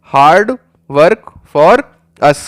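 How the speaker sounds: hard work for us stretched out word by word; slow teacher drill; slow repeat-after-me. Shown as a demonstration. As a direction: say hard work for us slowly so the class can echo. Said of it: "मेरे साथ साथ बोलो".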